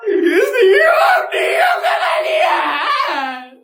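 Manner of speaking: furious tearful yell to shut them up; shrieking, near tears; angry shout. Acted. Girl yelling at mocking classmates.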